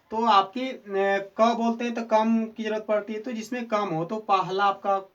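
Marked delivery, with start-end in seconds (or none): none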